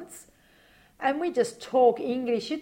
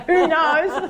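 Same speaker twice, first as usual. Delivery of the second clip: laughing; 8 semitones higher, 10 dB louder than usual. Real, not acted. Joking about the trip.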